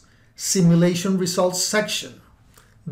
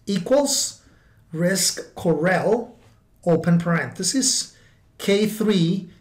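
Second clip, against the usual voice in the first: dictating. Shown as a demonstration. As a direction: pause between each symbol. Reading out a formula.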